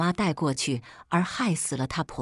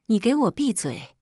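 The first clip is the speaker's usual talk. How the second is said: narrated angry outburst, higher pitch; enraged; 4 semitones higher than usual. A narrator's reading. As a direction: cut them off angrily, not shouting. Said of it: "我爸气疯了". (气急地喝止)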